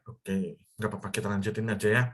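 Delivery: usual speaking voice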